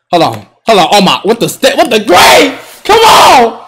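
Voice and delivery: building to a high, loud yell; rising shocked shout. Real, not acted. Gamer stunned by his own move, rising shout. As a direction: explode in disbelief.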